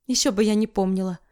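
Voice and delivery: plain reading voice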